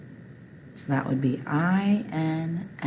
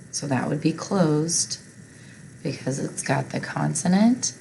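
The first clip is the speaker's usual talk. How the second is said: usual speaking voice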